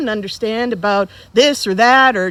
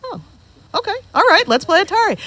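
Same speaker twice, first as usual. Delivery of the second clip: put-on little kid voice; 10 semitones higher, faster than usual. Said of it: "And I went".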